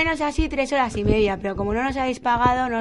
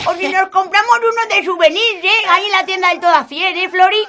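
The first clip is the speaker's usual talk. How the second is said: put-on gossipy old lady; 8 semitones higher, 5 dB louder than usual. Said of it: "son Florinda y Natividad".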